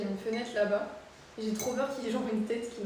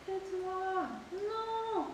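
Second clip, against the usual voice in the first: very high put-on little voice; squeaky voice; 9 semitones higher, much slower than usual. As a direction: pitch way up like a tiny creature.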